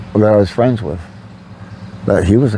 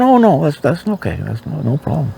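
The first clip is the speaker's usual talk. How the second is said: forced casual; 6 semitones higher than usual. Retold or imitated. Brushing off his friend's apology, much higher, forced casual. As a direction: fake it's-fine brush-off. Pretending he is not hurt.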